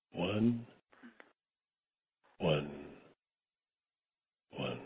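"one" low and soft, fading away; diminishing repetitions. Shown as a demonstration. Guiding listeners to relax, each "one" diminished. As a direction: repeat "one" ever fainter with long pauses. Diminishing count, as demonstrated earlier.

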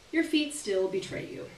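plain reading voice

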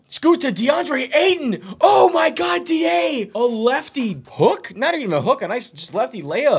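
rapid excited commentary; excited call. Real, not acted. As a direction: ride the thrill of the play. Late go-ahead layup.

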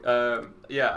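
ordinary talk

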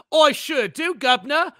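high, hammed-up fake British accent; bad British accent. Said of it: "how to do a British accent".

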